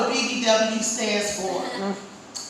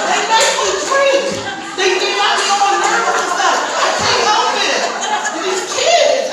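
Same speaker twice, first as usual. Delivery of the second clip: pitched-up mock frustration; higher, louder comic exasperation; 6 semitones higher, 5 dB louder than usual. Real, not acted. Comedian to the audience, pitched-up exasperated complaint.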